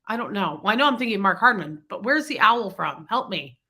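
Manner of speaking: ordinary talk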